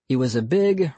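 usual speaking voice

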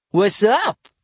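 high, loud, cocky hello; brash greeting. A narrator's reading. Bradley greets Matt at breakfast, brash and loud. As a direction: call it out like a pushy pal.

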